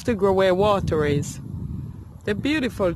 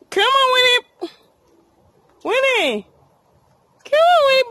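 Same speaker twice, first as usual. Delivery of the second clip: high, louder sing-song call; 14 semitones higher, 3 dB louder than usual. Real, not acted. Owner calling her dog back from afar, high and loud. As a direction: call her from across the field.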